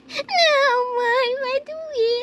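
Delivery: very drawn-out crying whine; tearful protest. Acted. Boy resists mother washing his cut, whining. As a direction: wail in dread of pain.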